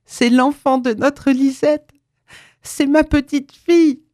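a mother's joy breaking into tears; tearful mother. A narrator's reading. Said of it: "ne pouvait plus retenir les larmes". (émue aux larmes, plus aiguë et plus forte)

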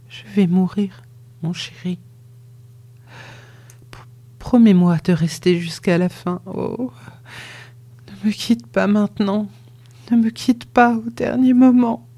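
lightly voiced dying wife begging her lover to stay; dying woman, distant and panting. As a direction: gasp it out from far away, clinging to him. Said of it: "d'une voix lointaine, en haletant".

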